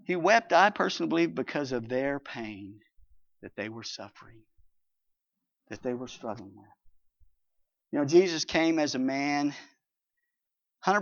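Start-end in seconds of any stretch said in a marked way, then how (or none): 1.3-6.7 soft and tender. Acted. Preacher softening on suffering.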